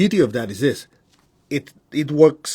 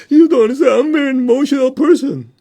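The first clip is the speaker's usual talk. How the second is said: much higher, louder mock whine; whiny student; 12 semitones higher, 8 dB louder than usual. People refusing help, mocked in a high whine. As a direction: plead like a melodramatic student. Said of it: "Some people say".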